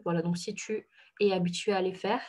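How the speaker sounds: ordinary delivery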